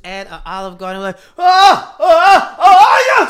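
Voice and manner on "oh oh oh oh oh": high, loud, shrieking oh oh oh; startled shrieks at a shocking moment. Real, not acted. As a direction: let out a sudden high-pitched yelp.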